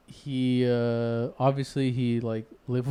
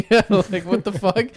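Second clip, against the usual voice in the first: loud, higher laughing mock outrage; 7 semitones higher, 7 dB louder than usual. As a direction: laugh through the grandpa rant. Laughing retelling grandpa again.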